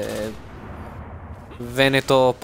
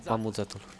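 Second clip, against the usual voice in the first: ordinary talk, nothing marked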